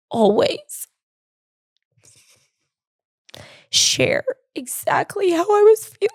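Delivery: fighting back tears on the words; voice breaking. Real, not acted. Remembering her late mother-in-law, voice cracking. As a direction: pitch jumps up as the voice cracks with tears. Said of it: "without crying".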